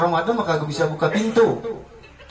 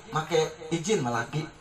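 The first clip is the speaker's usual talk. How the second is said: ordinary talk, nothing marked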